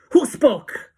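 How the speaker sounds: very loud, high burst; enraged shriek. A narrator's reading. Witch leader hunting the interrupter, furious shriek. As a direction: shriek it in rage. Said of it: "she shrieked".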